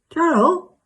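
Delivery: high, loud call rising at the end. Acted. To Cheryl after the call cuts out, high insistent call. Calling to friend after lost connection.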